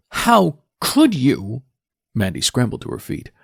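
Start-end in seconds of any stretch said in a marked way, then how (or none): 0.1-1.6 put-on high, slow, anguished teen girl; a betrayed daughter crying out; tearful daughter. Daughter confronts father, crying.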